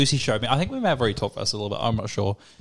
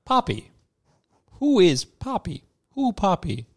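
higher, drawn-out button words; one word at a time, higher pitched; dog talk-button; 5 semitones higher, slower than usual. Retold or imitated. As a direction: imitate a dog questioning through talk buttons. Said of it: "it got to more like".

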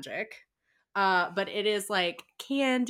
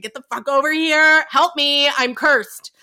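mock exasperated yell; 5 semitones higher, 9 dB louder than usual. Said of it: "he's like".

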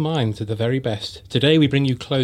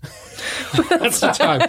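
laughing; 13 semitones higher, much slower than usual. Real, not acted. Jokes about her time line.

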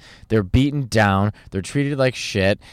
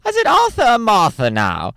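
higher, camp British voice; quoting Alan Carr; 9 semitones higher than usual. Retold or imitated. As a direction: tease her like a cheeky talk-show host. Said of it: "He's like".

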